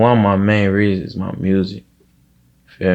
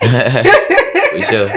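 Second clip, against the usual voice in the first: laughing through for sure; 4 semitones higher, 6 dB louder than usual. Real, not acted. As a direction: laugh the words out, louder. Laughing at nouns joke.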